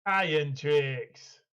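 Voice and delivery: slow, drawn-out Yorkshire dwarf voice; Yorkshire accent. Acted. Co-host echoing the dwarf quote back, slow Yorkshire accent. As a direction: echo the dwarf slowly in broad Yorkshire. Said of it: "Yeah, definitely Yorkshire".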